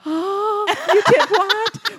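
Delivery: high, stretched-out mock astonishment; mock shocked mom. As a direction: play the horrified parent hearing a confession. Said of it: "told all these bad stories where I'm like".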